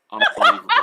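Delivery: high, loud burst of laughing disbelief; unbelievable, squealed through a laugh. Real, not acted. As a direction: cry it out, cracking up at the vote. Reading a mocking vote aloud.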